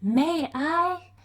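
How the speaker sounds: shy hopeful question; timid Cinderella. A narrator's reading. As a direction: ask meekly, high and small. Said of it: "said Cinderella".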